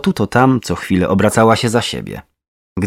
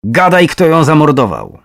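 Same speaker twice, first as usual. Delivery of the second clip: loud, raised angry demand; higher, insistent outburst; shouting; 5 semitones higher, 4 dB louder than usual. A narrator's reading. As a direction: demand an answer forcefully. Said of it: "krzyczała".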